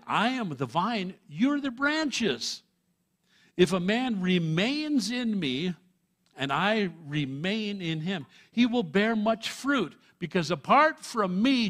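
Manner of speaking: loud, high, slow pulpit delivery; full-force preaching. Acted. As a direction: thunder the verse out to the congregation. Preaching Jesus' words full force.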